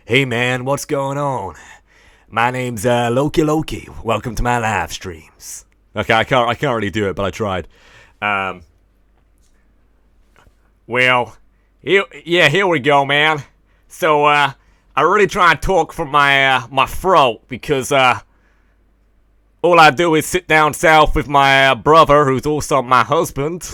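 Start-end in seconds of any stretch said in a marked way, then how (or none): 0.1-5.7 amateur Southern US accent by a Brit; attempted Southern accent. Shown as a demonstration. British streamer greeting viewers, trying a Southern accent. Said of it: "try an American accent? I mean, Southern accent". 10.9-23.7 throaty Southern caricature, pushed louder; mock Southern accent. Shown as a demonstration. British streamer playing a Southerner talking from the throat, mock accent. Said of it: "I mean, Southern accent".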